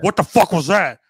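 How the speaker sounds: startled king. Retold or imitated. Gnome King voiced reacting.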